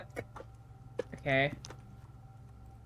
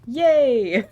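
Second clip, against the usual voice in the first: loud happy cheer; 5 semitones higher, 9 dB louder than usual. Real, not acted. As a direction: cheer it out with joy. Cheering a game event.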